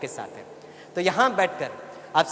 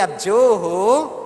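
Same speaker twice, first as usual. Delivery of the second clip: very slow, louder, long-held loving words; प्यार से बुलवाना; 5 dB louder, much slower than usual. Shown as a demonstration. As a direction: lead everyone in a drawn-out blessing. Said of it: "ठीक है, सभी बोलिए". (प्यार से, लंबा खींचकर)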